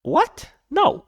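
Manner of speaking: high, incredulous What?; stunned disbelief. Real, not acted. Reacting to the clip in high disbelief. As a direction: caught off guard, can't believe it.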